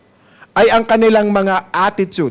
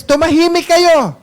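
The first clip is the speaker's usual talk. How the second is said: higher, sharp order to hush; angry command; 7 semitones higher than usual. Retold or imitated. Preacher voicing angry high priests telling crowd to hush. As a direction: act the priests silencing the crowd. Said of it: "nagalit naman yung mga high priest".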